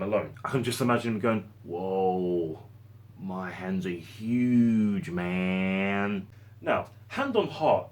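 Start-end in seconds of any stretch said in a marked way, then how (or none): 1.6-6.3 very slow, drawn-out stoner drawl; spaced-out weed smoker marvelling; stoned astronaut. Acted. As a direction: draw it out in dazed wonder, very stoned. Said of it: "smoking ten tons of weed".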